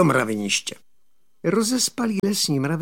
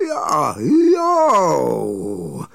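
loud howl of pain, drawn out; roar; 5 dB louder than usual. Acted. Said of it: "zarval loupežník". (bolestný protáhlý řev)

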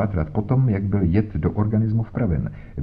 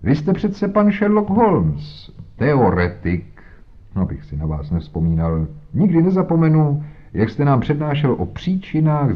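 pompous inspector. Acted. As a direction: lecture Holmes with condescension. Voiced inspector Jones talking. (nafoukaný inspektor, výš posazený hlas)

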